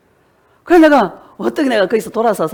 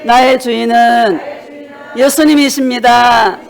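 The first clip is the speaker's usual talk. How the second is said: shouted confession; 3 semitones higher, 3 dB louder, slower than usual. Real, not acted. 교인들에게 함께 고백하자며 점점 커지는 외침. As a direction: cry it out slowly to the whole hall. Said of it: "고백합시다".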